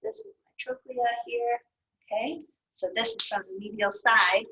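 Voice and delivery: ordinary talk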